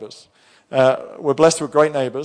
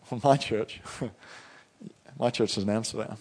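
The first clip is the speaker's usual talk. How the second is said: soft, chuckling humblebrag; proud understatement; 3 semitones lower, 10 dB quieter than usual. Retold or imitated. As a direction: say it low-key, with a proud little laugh. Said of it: "it's about the way I say it".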